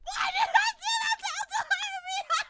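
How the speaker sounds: very high, loud screamed lament; shrieking over-the-top despair; anguished wail. Real, not acted. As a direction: howl it in regret. Streamer wails after losing.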